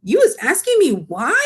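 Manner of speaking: a hint of an incredulous giant; lightly voiced giant, astonished; giant crying out. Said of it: "cried the B F G".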